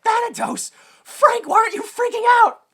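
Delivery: high, loud mock panic; hammed-up alarmed yelling; freaking out. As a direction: shriek it like a panicking passenger. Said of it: "and he freaks out because".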